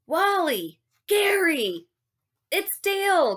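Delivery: high, loud calls across the river; shouting. Acted. Gary calling out as Dale arrives, loud shout. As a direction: call out to friends on the shore. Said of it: "Gary shouted".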